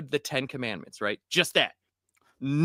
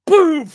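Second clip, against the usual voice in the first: loud, high shouted boom; yelled boom sound; imitated gunshot boom; 13 semitones higher, 13 dB louder than usual. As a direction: blast it out like a gunshot. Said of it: "it went".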